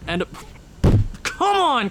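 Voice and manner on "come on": sudden high frustrated yell; exasperated shout. Real, not acted. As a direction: blurt it out at the screen. Frustrated at hockey sim results.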